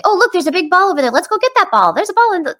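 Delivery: rapid, high-pitched running chatter; too-fast chatter. Shown as a demonstration. Parent overloading a little one with words, too-fast chatter. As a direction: rattle it off high without a break. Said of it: "like, really fat".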